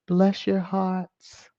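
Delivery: slow, sugary Southern "Bless your hearts"; Southern saying. Shown as a demonstration. To the haters, a slow Southern-style blessing. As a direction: drawl it slow like a Southern lady. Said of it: "I live in the South now".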